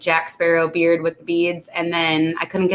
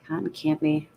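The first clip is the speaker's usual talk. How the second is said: softer, stuffed-up delivery of the name; congested; 2 semitones lower, 8 dB quieter than usual. Real, not acted. Reading a drink name to stream chat, suddenly congested. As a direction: say it with a blocked-up nose. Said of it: "I just got congested all of a sudden".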